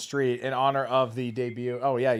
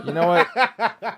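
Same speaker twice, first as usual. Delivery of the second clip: laughing; 4 semitones higher, 9 dB louder, much slower than usual. Real, not acted. Laughing, slow mock threat.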